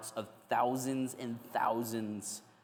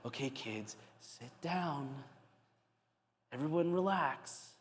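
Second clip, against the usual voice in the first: calm leader; 6 dB quieter than usual. Acted. Leader calming followers, voiced.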